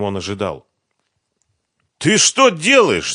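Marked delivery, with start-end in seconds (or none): 2.0-3.1 drawn-out, high, loud shout; yelling. A narrator's reading. Фёдор watching his money being thrown away, yelling. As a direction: yell in outraged alarm. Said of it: "заорал Фёдор".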